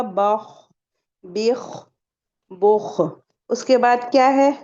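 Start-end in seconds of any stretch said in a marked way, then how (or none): 0.0-3.0 बख, बिख, बुख one by one with full raised kh; correct heavy kh. Shown as a demonstration. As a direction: separate syllables बख, बिख, बुख, tongue root lifted. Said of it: "तो आपको कहना होगा".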